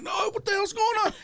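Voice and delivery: very high, incredulous outburst; acted exasperated protest. Inmate accused of wanting a fight, acted exasperated protest. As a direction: push the voice way up in mock disbelief.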